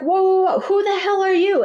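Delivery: high, loud, alarmed yelling; alarmed shout. Retold or imitated. Confronting the man tugging her sash, high loud alarmed shout. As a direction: shout at the stranger grabbing you.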